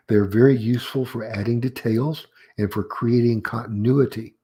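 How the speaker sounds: usual speaking voice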